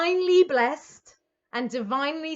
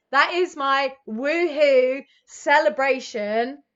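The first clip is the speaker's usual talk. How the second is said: drawn-out, savoured words; slow savouring; 4 dB louder, slower than usual. Real, not acted. Reader savouring the celebration card for viewers, slow. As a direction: stretch each word to savour it. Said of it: "Let me tell you that".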